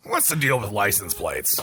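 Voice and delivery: lightly voiced grumpy old commentator; low, soft curmudgeon complaint; Andy Rooney. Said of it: "Andy Rooney".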